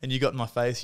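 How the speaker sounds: usual speaking voice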